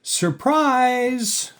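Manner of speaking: very high, held party cheer; excited shout. A narrator's reading. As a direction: sing it out as a surprise greeting. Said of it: "all the ladies shouted".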